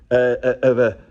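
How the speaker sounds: ordinary delivery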